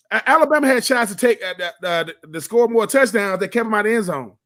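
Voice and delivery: normal talking voice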